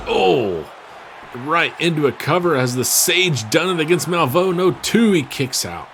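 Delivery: high, loud near-fall call; wrestling commentator. Real, not acted. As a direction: shout the near fall, rising.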